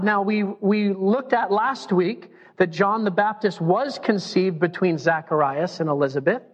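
usual speaking voice

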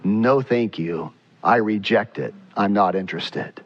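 a lightly voiced God rejecting it; a hint of God closing the door; God's firm refusal. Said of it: "And God says".

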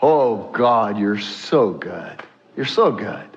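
low, slow, hushed awe; grateful worshipper. Acted. Delivered believer to God, low and reverent thanks. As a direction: sink down into slow, reverent gratitude. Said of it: "you know what you're gonna say".

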